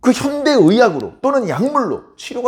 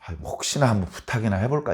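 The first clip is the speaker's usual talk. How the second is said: soft, casual half-hearted shot at asking; idle, noncommittal musing; offhand maybe; 7 semitones lower, 7 dB quieter than usual. Retold or imitated. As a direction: shrug it off, just trying one's luck. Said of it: "이게 아니고요".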